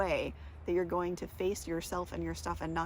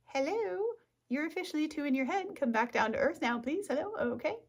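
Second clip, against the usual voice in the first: high, bright mock scolding of herself; mock inner voice; 7 semitones higher than usual. To herself, spacing out, high teasing mock voice. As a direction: chide yourself in a high, teasing voice.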